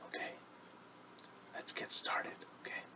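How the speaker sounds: hushed whisper. Shown as a demonstration. Teacher whispering to begin the story. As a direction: start the lesson in a whisper.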